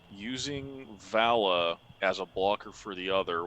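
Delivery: usual speaking voice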